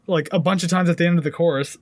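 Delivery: ordinary talk, nothing marked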